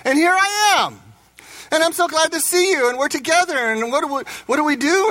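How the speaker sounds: high, gushing acted joy; excited reunion. Marie reunited with her husband in heaven, gushing. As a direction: pitch it right up, thrilled to be together.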